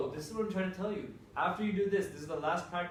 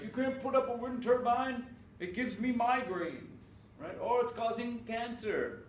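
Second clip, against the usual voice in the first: higher, whiny complaining neighbor voice; mocking wind turbine complainer; 7 semitones higher than usual. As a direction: mimic a fussy anti-turbine crank. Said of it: "the wind turbine people who are like".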